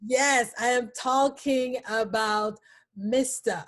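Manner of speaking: ordinary talk, nothing marked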